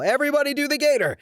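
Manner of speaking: quick, very high-pitched yelled call; high, rapid rallying cry; band singer's yell. Retold or imitated. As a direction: yell it out to get the whole floor down. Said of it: "I yell".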